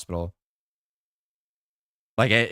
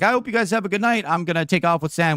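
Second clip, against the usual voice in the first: higher-pitched retold buddy's farewell; imitating the prankster; 6 semitones higher than usual. Buddy signing off after his prank, lightly imitated in higher voice. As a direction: lightly mimic him, pitched up. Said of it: "And he's like".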